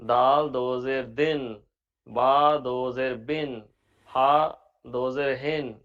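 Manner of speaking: slow, each ending 'دن' 'بن' 'ہن' sounded with a clear -in; double zer as -in. Shown as a demonstration. Teacher showing students how double zer sounds, slow and deliberate. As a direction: spell it out slowly, stressing the 'با' and the final -in. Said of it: "اس طرح اس کی ادائیگی ہوگی".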